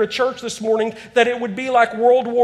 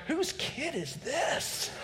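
slow, hushed aside under the breath; sheepish slow murmur, barely voiced; embarrassed mutter; 4 semitones higher, 16 dB quieter, much slower than usual. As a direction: pretend the screaming kid isn't yours. Embarrassed dad in restaurant.

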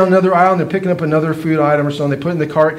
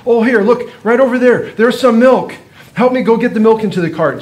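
higher, brighter mock enthusiasm to distract a child; cheerful distraction; 6 semitones higher, 4 dB louder than usual. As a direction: perk up to pull his attention away.

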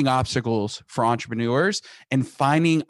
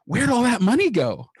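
mock panicked wife; 9 semitones higher than usual. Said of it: "she's panicked".

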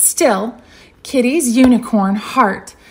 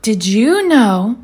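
ordinary delivery, nothing marked

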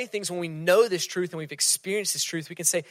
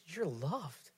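soft, slow, much quieter; tender reassurance; 12 dB quieter, much slower than usual. Acted. God the Father telling a hurting person, softly and tenderly. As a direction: speak it like a father to a hurting child. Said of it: "will look at you and say".

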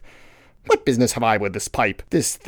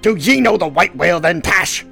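loud, high, urgent question; eager shouted demand; Ahab; 9 semitones higher, 8 dB louder than usual. Acted.

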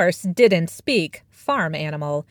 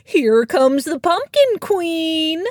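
high, slow honking goose voice; 9 semitones higher, 3 dB louder, slower than usual. Acted. Goose greeting the cat in the barn, high and slow. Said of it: "Marcus the goose would honk".

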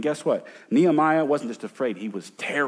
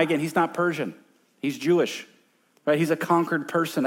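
ordinary talk, nothing marked

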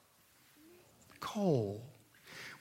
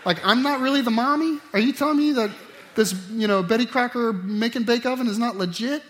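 put-on high, loud, outraged child voice; mock a kid betrayed by the game; hammed-up offended child; indignant kid; 5 semitones higher, 5 dB louder than usual. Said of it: "they don't go".

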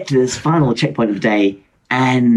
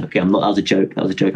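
ordinary talk, nothing marked

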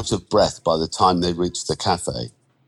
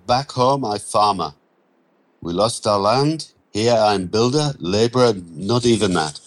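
put-on halting broken English, Romanian accent, a touch sad; 3 semitones higher than usual. Andrei telling Mitch he lost his farm, Romanian accent. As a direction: Romanian accent, understated regret.

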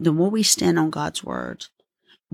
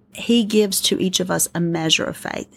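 usual speaking voice

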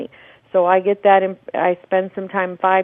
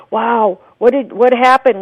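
gasp, then a louder imitated audience wow; amazed gasping; 4 semitones higher, 6 dB louder than usual. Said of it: "you'd hear them gasping, going".

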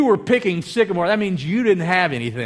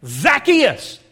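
Jesus calling up to Zacchaeus, loud, higher call; 8 semitones higher, 5 dB louder than usual. Retold or imitated. Jesus calls to the man up in the tree, calling out. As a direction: call the name out loud and high. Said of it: "he looked up and he said to him".

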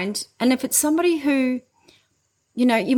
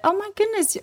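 surprised; 11 semitones higher than usual. Retold or imitated. Grateful client quoted.

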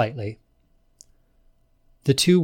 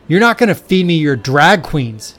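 sudden loud blurt, a touch higher; 4 semitones higher, 9 dB louder than usual. A narrator's reading. Todd to Kate on a city sidewalk, loud sudden blurt. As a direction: blurt out the fear before thinking. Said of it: "he blurted out".

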